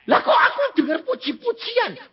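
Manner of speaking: high, laughing question; laughing wonder. Retold or imitated. Wife asking husband about the singing, laughing and high. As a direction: ask it delighted, laughing.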